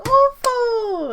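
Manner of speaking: very high, loud shriek of awful; squealed verdict. Acted. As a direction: shriek the word. [name] judges Sam.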